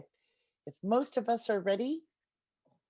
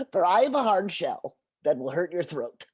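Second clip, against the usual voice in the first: turtle voice; 4 semitones lower, 5 dB louder than usual. Acted. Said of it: "said the turtle".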